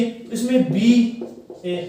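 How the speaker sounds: बीए drawn out very slowly; slow lip-sound demo. Shown as a demonstration. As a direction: stretch बीए to show the b sound. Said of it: "ऐसे देखो यहाँ पे बीए".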